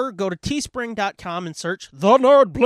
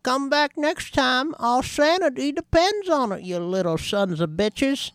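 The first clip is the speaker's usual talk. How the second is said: creepy voice; 6 semitones higher than usual. Pretend. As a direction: drag it out like a creepy old ghoul. Creepy sign-off voice.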